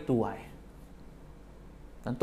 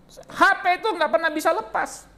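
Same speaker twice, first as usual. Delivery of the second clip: mock annoyed; 14 semitones higher, 4 dB louder than usual. Wife annoyed at phone.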